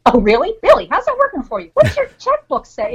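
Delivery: high, sarcastic taunting voice; mocking ego voice. Inner ego taunting her about money, high mocking sneer. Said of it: "that nasty little bugger in our head goes".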